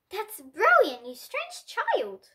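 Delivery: usual speaking voice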